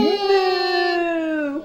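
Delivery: high, drawn-out playful moo; cow moo. Shown as a demonstration. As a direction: moo back brightly for the baby. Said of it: "What does the cow say?".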